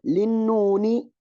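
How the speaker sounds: للنون stretched slowly with a rising end; drawn-out teaching word. Shown as a demonstration. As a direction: hold للنون long and lift it as a cue. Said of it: "teaches verse word by word". (مدّ كلمة للنون بنبرة صاعدة)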